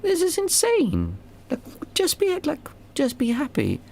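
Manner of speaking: a lifted, wide-eyed high voice of sudden clarity; high incredulous realization. Retold or imitated. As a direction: voice leaps very high in amazed disbelief. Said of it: "you go like".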